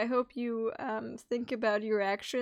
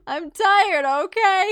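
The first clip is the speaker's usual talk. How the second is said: louder, mocking the friend's excuse; mock whiny quote; 5 semitones higher, 12 dB louder, slower than usual. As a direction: parrot his excuse loudly and sarcastically. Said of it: "he says".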